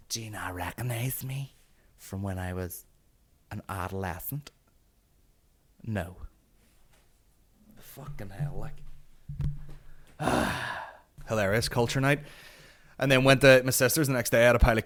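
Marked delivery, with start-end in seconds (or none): 0.1-8.8 very soft, near-whispered incredulity; hushed disbelief. Retold or imitated. Comic repeating the question to himself, hushed disbelief. 10.2-10.9 low breathy exhale; weary sigh. Real, not acted. Comic winding down after his rant, weary sigh.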